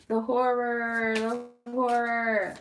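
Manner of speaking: slow, high melodramatic wail; mock horror. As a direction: draw it out in mock despair. Melodramatic lament over spilled soda.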